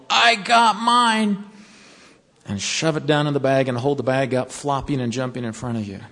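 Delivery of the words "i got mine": high, slow, loud whoop of victory; a slow triumphant shout, I pitched way up; triumphant yell. Retold or imitated. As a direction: crow it out like you won. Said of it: "and he yelled".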